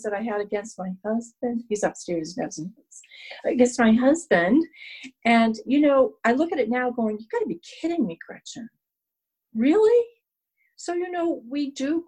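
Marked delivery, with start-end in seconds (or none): 1.7-3.5 quick hushed whisper, as if he might hear; whispered aside. Real, not acted. Aside about husband upstairs. 7.2-10.1 fast, much higher, disbelieving at herself; mock yourself in disbelief, pitch jumping on "Really"; incredulous self-scolding. Scolding her past self.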